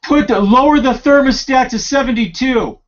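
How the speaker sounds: high, loud, irritated call about the heater; shouting across the house. Real, not acted. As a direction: bark it so Ricky hears you downstairs. Shouting order to Ricky.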